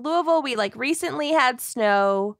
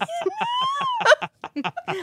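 high giggle; 4 dB quieter than usual. Real, not acted. Laughing during introductions.

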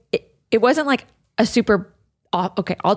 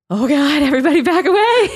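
hammed-up stressed yell, higher and louder; mock angry outburst; 8 semitones higher, 6 dB louder than usual. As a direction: play a dad losing his temper. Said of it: "it's like".

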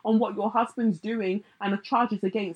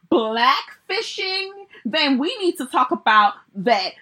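hammed-up rapper accent, high, loud and drawn out; [name]; 5 semitones higher, 7 dB louder, slower than usual. Mimicking the rapper defending blackfishing on Instagram live, exaggerated accent. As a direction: badly imitate the rapper's accent, stretching key words. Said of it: "I can't even do her accent".

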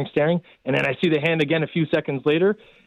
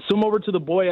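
usual speaking voice

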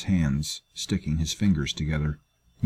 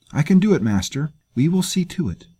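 put-on much higher, eager girl's voice; eager young girl; 9 semitones higher, 3 dB louder than usual. Slave girl answers her master, high and eager. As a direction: promise it brightly and willingly.

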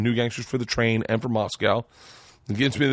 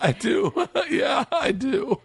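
laughing agreement; 12 semitones higher, slower than usual. Real, not acted. Laughs at being called bearded baby.

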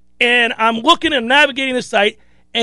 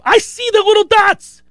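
agitated; 7 semitones higher than usual. Retold or imitated. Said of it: "I'm agitated".

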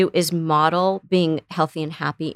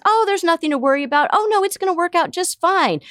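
high, quick mock-soothing brush-off; dismissive reassurance; 11 semitones higher than usual. Parent dismissing a worried kid, high mock reassurance. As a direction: wave the worry away, too breezy. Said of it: "And the parent says".

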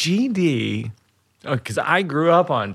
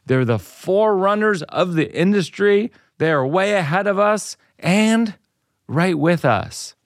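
upbeat host-read commercial; 3 semitones higher than usual. Acted. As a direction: sell it like a podcast ad. Host reads sponsor ad.

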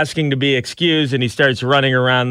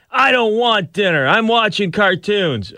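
put-on child whining; 9 semitones higher than usual. Son voiced in story.

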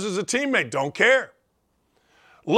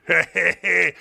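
fake, forced chuckle; mock chuckle; 2 semitones lower than usual. Everybody chuckling at Peyton, fake laugh. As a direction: chuckle like an easily amused fan. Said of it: "everybody chuckles".

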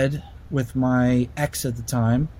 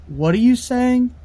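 high, pitched-up puzzled question; ex confused; 10 semitones higher than usual. Retold or imitated. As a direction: echo the dream girlfriend, uncanny. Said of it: "she wakes up and she goes".

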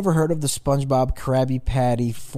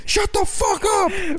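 slow mock yell, high; angry neighbor shout; much slower than usual. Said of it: "They come knocking".

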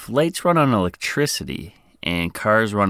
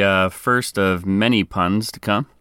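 usual speaking voice

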